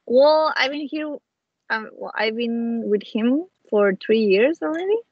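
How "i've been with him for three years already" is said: normal talking voice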